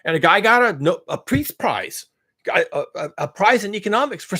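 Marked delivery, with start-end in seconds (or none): none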